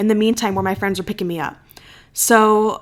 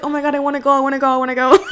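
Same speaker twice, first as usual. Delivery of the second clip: higher-pitched retold cringe; cringing; 4 semitones higher, much faster than usual. Retelling her reaction to his compliment, mock cringing panic. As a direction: rattle it out, desperate to escape. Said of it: "I'm, like, immediately cringing".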